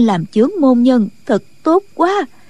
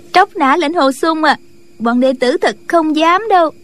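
high-pitched disciple voice; amused young disciple; 6 semitones higher than usual. Acted. Disciple answers her leader's teasing, high-pitched. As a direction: deny it in a raised girlish pitch.

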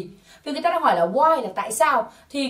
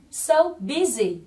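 very slow, raised-pitch model phrase; đọc mẫu chậm; 4 semitones higher, much slower than usual. Shown as a demonstration. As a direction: stretch both words for learners to copy. Giáo viên đọc mẫu cho lặp lại. (đọc mẫu thật chậm, giọng cao hơn)

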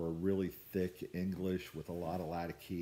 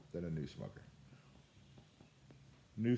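speaking with pipe in mouth; talking around a pipe stem; 3 dB quieter than usual. Real, not acted. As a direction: pipe clenched between lips. Seen 1: Pipe stem held in lips 0.9-3.7, again from 5.1.

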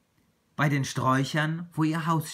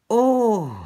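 loud, high cry of wonder; calling out; 9 semitones higher, 10 dB louder than usual. A narrator's reading. Little tiger seeing the land from the tree, calling out. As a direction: exclaim it as an amazed little tiger. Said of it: "rief der kleine Tiger".

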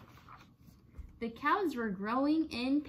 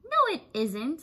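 louder, higher, indignant; a little cross; 5 semitones higher, 5 dB louder than usual. A narrator's reading. Shelley answers the dog, a little cross. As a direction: push back, mildly cross. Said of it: "feeling a little cross".

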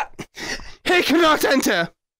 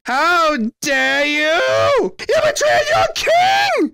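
slow, high, furious shout; deposed king raging at his children, furious shout; outraged king; 6 semitones higher, much slower than usual. Acted. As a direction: roar it like a betrayed king.

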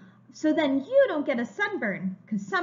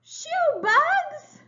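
high, loud shoo-away cry; shooing ants; 6 dB louder, much slower than usual. Acted. Reader shooing ants away from the picnic, high and loud. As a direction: chase the bugs off the picnic.

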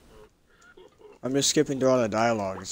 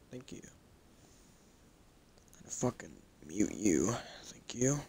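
whispering; 2 semitones lower, 8 dB quieter, much slower than usual. Real, not acted. Hushing the phone mid-recording.

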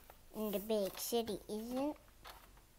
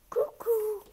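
higher, louder cuckoo; cuckoo call; 9 semitones higher, 5 dB louder than usual. Acted. Bird calls at the running puppy, loud cuckoo.

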